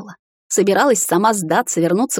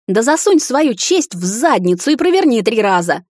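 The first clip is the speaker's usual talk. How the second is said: higher, fast angry outburst; blurted out; 4 semitones higher, 4 dB louder than usual. A narrator's reading. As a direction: fire it out all at once. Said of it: "выпалила я".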